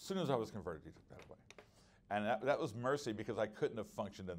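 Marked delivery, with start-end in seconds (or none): 0.8-1.4 whispered. Shown as a demonstration. Preacher whispering to the congregation about God's mercy. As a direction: drop to an unvoiced whisper. Repeats line, second take whispered.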